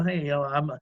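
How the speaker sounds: usual speaking voice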